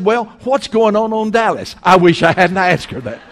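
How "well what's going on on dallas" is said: a touch of eager, nosy curiosity, pitched higher; eager question. Retold or imitated. Preacher retelling asking the cashier about Dallas, mildly eager. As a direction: ask it like you can't wait to hear the gossip. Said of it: "like somebody that had lost their mind".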